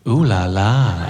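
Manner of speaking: slow, low, knowing "ooh la la"; sassy toddler. Retold or imitated. Comic imitating his daughter after she threw her porridge, in a slow sassy drawl. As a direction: drawl it out with cheeky attitude. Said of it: "looks at me in the eyes and goes".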